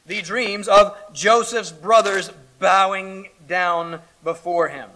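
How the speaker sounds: usual speaking voice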